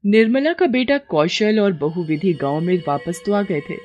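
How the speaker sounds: ordinary delivery, nothing marked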